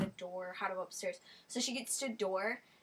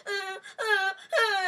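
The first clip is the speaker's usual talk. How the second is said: high whimpering uh sounds; scared blonde on the stairs; 10 semitones higher, 9 dB louder, much slower than usual. Retold or imitated. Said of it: "scared of stairs".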